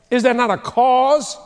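loud, high rallying question; ringing challenge. Acted. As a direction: call it out boldly. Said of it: "he goes".

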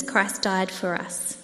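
ordinary talk

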